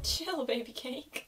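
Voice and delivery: soft, slow echo of the line; hushed, slowed imitation; quiet mimic. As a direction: murmur it back, amused. Echoing a film line.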